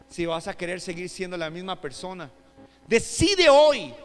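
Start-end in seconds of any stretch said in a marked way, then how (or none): 2.9-3.9 louder, higher, drawn-out urging; forceful appeal. Real, not acted. Preacher pressing the congregation to decide, forceful appeal. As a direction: press the decision home slowly and loudly.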